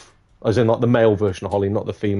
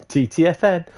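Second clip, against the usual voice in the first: laughing echo; 7 semitones higher than usual. Real, not acted. Laughs repeating friend's sign-off.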